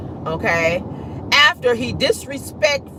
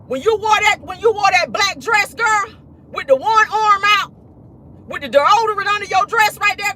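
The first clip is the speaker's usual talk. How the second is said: mock sassy retelling; 11 semitones higher than usual. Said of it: "He said".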